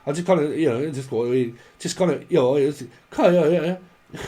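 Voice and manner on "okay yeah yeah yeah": mock Cornish. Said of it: "right proper accent".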